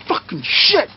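high, strained mock rage; retold flipping out. Wrestler backstage after the match, retold furious outburst. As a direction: swear in a reenacted tantrum. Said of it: "I'm flipping out".

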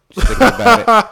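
mock anguish. Mock pain over wallet.

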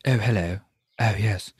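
a lightly voiced prim British gent, mild; hint of a stiff upper-lip Englishman; mock posh Brit. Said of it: "I found it all very British".

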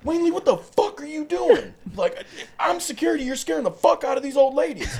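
very high, loud scolding yell; exasperated shout. Retold or imitated. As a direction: yell at him as security. Security guard yelling at Wayne.

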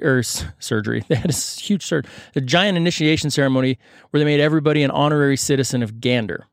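ordinary talk, nothing marked

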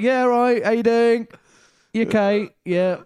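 talking up the phone friend, high and loud; eager greeting. Retold or imitated. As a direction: act giddy greeting a stranger on the phone. Mimicking himself greeting phone mate.

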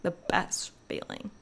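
near-whispered, savoring repeat; hushed emphasis. Real, not acted. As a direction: drop to a soft, breathy hush.

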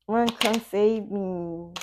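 slow, stretched-out mock begging; slow drawn plea. Reader voicing the ex, slowly drawn-out plea. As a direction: drag each word out like a sigh.

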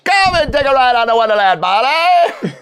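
loud carnival barker. Pretend. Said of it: "carnival barker".

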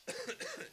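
sudden hard cough; throat-clearing cough mid-sentence; coughing fit. Real, not acted. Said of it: "I'm coughing all night".

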